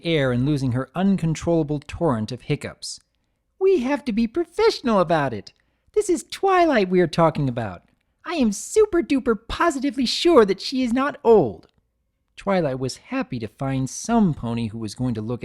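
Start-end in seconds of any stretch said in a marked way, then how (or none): 3.6-11.7 high, bubbly fake seriousness; pretending to be serious; mock professional Pinkie.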